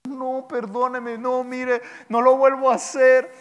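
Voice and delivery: mock childish plea, rising; pleading child. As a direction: beg like a caught kid. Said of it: "te afligías y decías". (niño suplicando, imitado)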